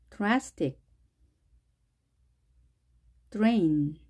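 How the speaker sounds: each word read slowly and clearly, long pauses between; English word list reading. Shown as a demonstration. Teacher reading a dr word list to students, careful. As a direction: model every word carefully for learners to repeat.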